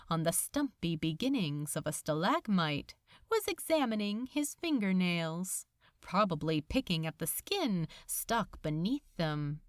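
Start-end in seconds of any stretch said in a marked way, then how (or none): none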